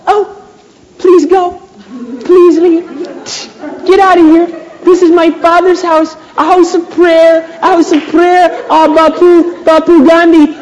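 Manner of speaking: high-pitched, parodied pleading; hammed-up feeble peacemaker in a put-on high voice; mock pacifist Jesus. Preacher parodying a Gandhi-like Jesus at the temple, mock pleading.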